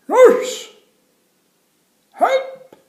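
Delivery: very high, loud cry for the nurse; calling for help. Acted. Jim calling the nurse, very high and loud. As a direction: wail it down the corridor.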